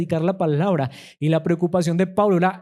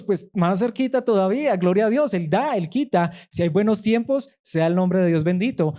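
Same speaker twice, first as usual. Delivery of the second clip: higher, rising preaching to the congregation; fervent preaching; 5 semitones higher than usual. Acted. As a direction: lift the whole church with each line. Preacher builds to fervent climax.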